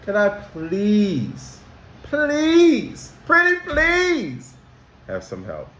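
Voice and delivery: high, slow begging please, pretty please; pleading kid. Shown as a demonstration. Teacher acting a child begging for help. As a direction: beg sweetly like a little kid. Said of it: "you say".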